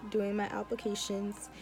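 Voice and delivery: ordinary delivery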